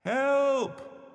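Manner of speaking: very high cry for help; call for help. A narrator's reading. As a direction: call out for rescue, high-pitched. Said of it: "called the voice".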